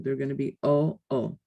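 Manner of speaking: O-O said as two distinct o sounds, not oo; separate O-O. Shown as a demonstration. Teacher contrasting Spanish O-O with English oo. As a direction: pull the two O's of O-O apart. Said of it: "These O's don't combine to be oo".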